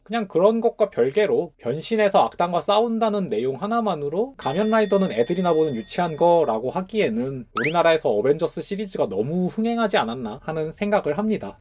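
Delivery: ordinary delivery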